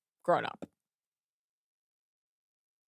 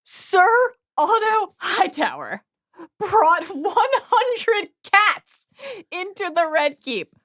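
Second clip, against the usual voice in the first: higher, slow, grand storyteller reveal; dramatic reading; 10 semitones higher, slower than usual. Acted. Host reads the book's cat twist aloud, drawn out and grand. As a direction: draw out the punchline like an epic.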